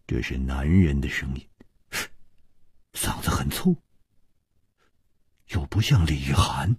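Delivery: hushed, pondering; musing whisper. A narrator's reading. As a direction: think aloud in a whisper. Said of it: "楚留香沉吟着说". (沉吟着低声耳语)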